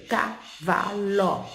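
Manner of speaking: cavalo drawn out slowly, each syllable separate; sounded out slowly. Shown as a demonstration. Teacher reading the child's writing aloud, slowly. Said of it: "Embaixo, vem".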